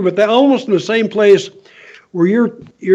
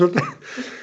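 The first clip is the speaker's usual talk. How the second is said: high laugh; 6 semitones higher, 8 dB quieter than usual. Real, not acted. Laughs at his own prank.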